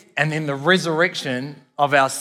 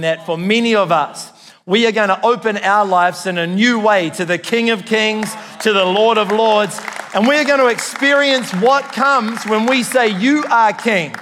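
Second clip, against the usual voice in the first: louder, higher preaching build; 5 semitones higher, 5 dB louder than usual. Acted. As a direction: lift it to a rousing declaration. Rising to a preaching climax.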